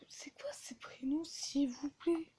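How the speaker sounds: unvoiced whisper, slow at the end. Real, not acted. Student whispers in class about the strange names. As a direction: whisper it to your neighbour.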